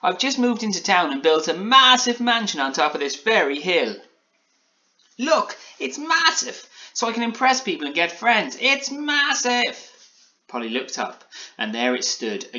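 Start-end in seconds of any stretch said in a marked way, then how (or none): 0.0-4.0 high, loud boasting; Alan Taylor cheering up. A narrator's reading. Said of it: "he said, cheering up". 5.2-9.8 very high, squeaky show-off; excited boasting. A narrator's reading. Gingerbread man boasts about mansion.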